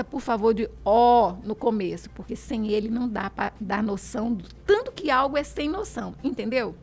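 usual speaking voice

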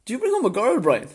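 high, loud teasing father; teasing dad. Retold or imitated. Dad asking Brian about a girl, high teasing voice. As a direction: tease Brian like a curious dad.

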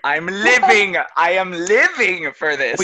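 loud, drawn-out gushing on "living"; delighted excitement. Real, not acted. Actor loving the friends' accent game, real delighted excitement. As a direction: revel in it, stretching the words out.

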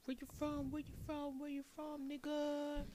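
high, soft, repetitive mimicry; mock challenge. Cohost echoing host's question, mock challenge. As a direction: chant it small and high as a send-up.

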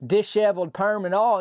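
usual speaking voice

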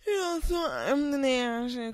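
lazy slow drawl; loose, overly comfy; too comfortable. Pretend. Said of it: "If I'm too comfortable, I'm be like".

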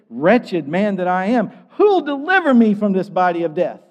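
high, loud, impassioned plea; crying out hopefully. Acted. Preacher voices Paul preaching to himself, hopeful outcry. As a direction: cry out loud for rescue. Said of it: "He's crying out in hope".

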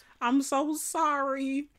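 high, drawn-out fake-weepy apology; slow, whiny mock sob; mock crying. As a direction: imitate a tearful, overdone apology. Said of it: "here come the privileged person crying".

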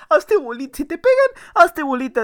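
Dui's squeaky advice. Retold or imitated. Narrator imitating Dui's panicked advice during the fight, high squeaky voice. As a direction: squeal the advice like a frightened kid. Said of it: "dijo Dui".

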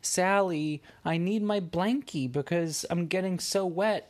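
lion in the rain asks Sally for help, high and pleading; crying lion voice. Acted. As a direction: beg your friend for help like a sad lion. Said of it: "the lion was crying and it said".

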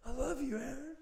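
put-on very high gushing drunk voice. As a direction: gush it out, drunk and sappy. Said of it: "You went through the".

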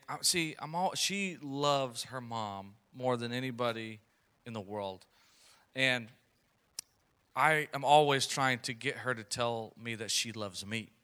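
ordinary talk, nothing marked

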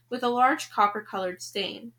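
ordinary delivery

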